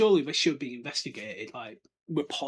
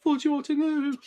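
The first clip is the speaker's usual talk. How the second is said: high, slow, loud panicked yell; film-style shout; 13 semitones higher, 6 dB louder, slower than usual. Retold or imitated. Quirrell charging into the hall, panicked yell. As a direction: bellow the warning as he charges in. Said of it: "properly shouting".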